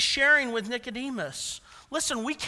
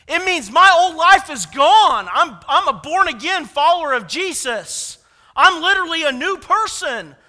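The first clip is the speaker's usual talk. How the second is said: loud preaching; 5 semitones higher, 10 dB louder than usual. Real, not acted. Preacher to congregation, shouting with fervor. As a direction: preach it out with full force.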